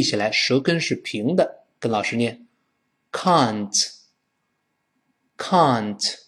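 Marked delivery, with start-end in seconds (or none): 3.1-6.1 very slow "can't", open "ah" closing to N; drawn-out British "can't" for learners. Shown as a demonstration. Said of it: "前面发啊".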